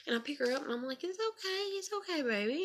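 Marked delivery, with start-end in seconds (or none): 1.0-2.6 put-on high, gentle hush for a crying child; soothing toddler. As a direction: coo it to a bawling two-year-old, much higher. Mother soothing her crying toddler.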